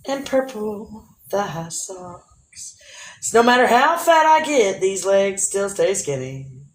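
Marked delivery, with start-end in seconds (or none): 0.1-2.8 sultry reveal. Acted. Slow sultry outfit reveal.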